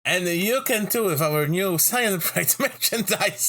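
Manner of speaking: high, drawn-out salesman parody; high hammed-up announcer; mock advert pitch. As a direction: hawk merchandise with a laugh.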